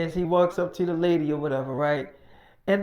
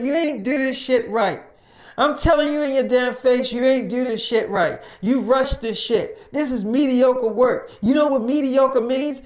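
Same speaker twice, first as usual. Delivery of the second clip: loud, higher scolding rant; angry scolding; 5 semitones higher, 4 dB louder than usual. Retold or imitated. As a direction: chew him out for sloppy work. Acted quarrel, Mike scolding worker.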